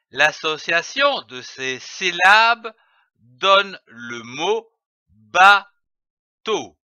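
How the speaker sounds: normal talking voice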